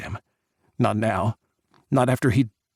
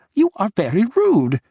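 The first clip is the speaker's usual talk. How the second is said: much higher and louder, indignant; offended outburst; 10 semitones higher, 6 dB louder than usual. Acted. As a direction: scold him, outraged. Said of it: "she snapped".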